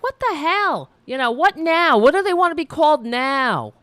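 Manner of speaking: high, loud, indignant retort; an imitated coworker who feels put-upon and fumes; exasperated outburst. Coworker fuming at being corrected, loud and high exasperation. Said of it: "He was like".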